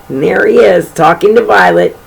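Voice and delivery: ordinary delivery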